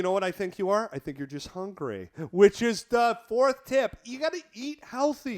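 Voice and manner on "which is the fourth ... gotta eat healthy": announcer voice. Acted. Announcing the next tip.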